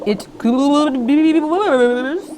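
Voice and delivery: very slow, stretched, bubbly; slow muffled underwater line; slow underwater words. A narrator's reading. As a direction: drag it out as if underwater. Underwater, George speaks slowly.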